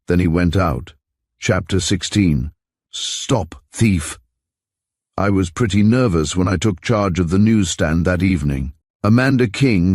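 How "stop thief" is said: usual speaking voice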